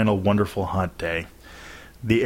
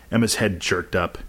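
plain reading voice

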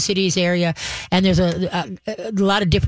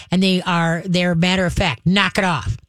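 normal talking voice

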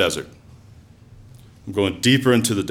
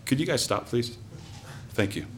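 ordinary talk, nothing marked

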